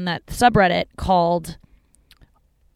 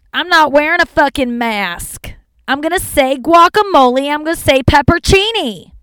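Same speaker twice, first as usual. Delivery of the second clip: much higher and louder, a defiant put-on voice declaring it; hammed-up stubborn declaration, voice pitched up and loud; defiant mock rant; 9 semitones higher, 10 dB louder than usual. Driver insisting on her own pronunciation, in a loud, defiant put-on voice. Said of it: "God, I sound like fucking".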